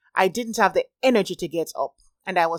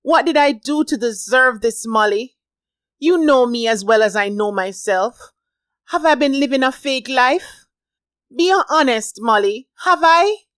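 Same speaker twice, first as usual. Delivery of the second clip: distressed pleading; 5 semitones higher, 6 dB louder than usual. A narrator's reading.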